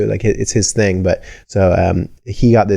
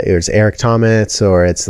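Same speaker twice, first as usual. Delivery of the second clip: normal talking voice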